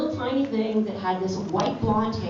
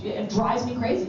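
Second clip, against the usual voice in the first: normal talking voice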